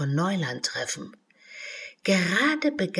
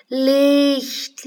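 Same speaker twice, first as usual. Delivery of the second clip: loud, high call; 8 semitones higher, 7 dB louder than usual. Acted. Alice shouts into the cave, loud and high. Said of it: "kam als hundertfaches Echo zurück".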